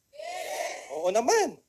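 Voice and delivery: high squealed yes; excited yes. Acted. Speaker answering own question, excited squeal. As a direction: burst out with a cheer.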